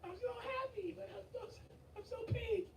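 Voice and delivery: squealing joy. Real, not acted. Big donation, overjoyed streamer.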